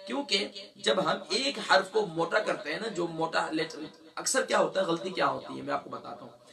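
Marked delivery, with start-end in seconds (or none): none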